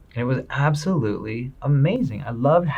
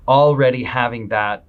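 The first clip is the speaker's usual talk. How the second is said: ordinary delivery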